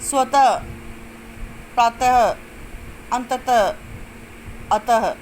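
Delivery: स्वतः, प्रातः, अंततः, अतः each ending in a breath of h; careful word list, releasing the ending of स्वतः, प्रातः, अंततः, अतः like ह; visarga as h. Shown as a demonstration. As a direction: sound out each word singly with a clear final "ha". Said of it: "ह की तरह किया जाता है".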